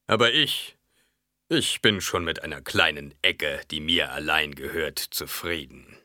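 orate insistently, then sink into a modest offer; chattering baron. Acted. (eindringlich redender Baron, erst laut, dann gesenkt)

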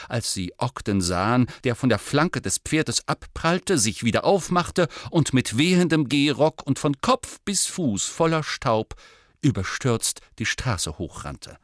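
ordinary delivery

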